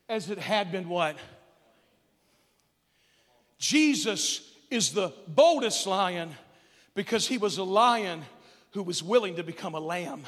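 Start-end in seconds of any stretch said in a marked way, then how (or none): 3.6-6.3 slow weighty stress on "boldest"; slow emphatic statement. Acted. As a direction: let "boldest" land slowly. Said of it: "climactic thesis stated slowly".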